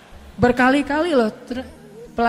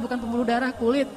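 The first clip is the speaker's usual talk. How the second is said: ordinary delivery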